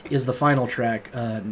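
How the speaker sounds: normal talking voice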